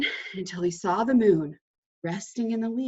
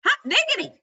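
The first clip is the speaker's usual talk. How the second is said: high, loud delighted cry; excited exclamation; 6 dB louder, faster than usual. A narrator's reading. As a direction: burst out with glee. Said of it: "exclaimed Mole".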